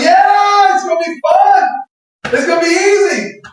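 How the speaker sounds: high, gleeful mock enthusiasm; high-pitched excited acting-out; naive eagerness. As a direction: cheer it like an eager volunteer. Acts out eager, naive response.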